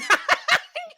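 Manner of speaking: a high, squealing laugh; high burst of laughter. Real, not acted. Cohost cracking up at the instant no, high laugh.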